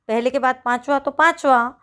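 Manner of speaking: ordinary talk, nothing marked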